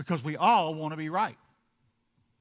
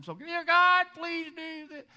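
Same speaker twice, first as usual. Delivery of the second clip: mock pious plea; 12 semitones higher, 4 dB louder, slower than usual. Mock prayer of pretender.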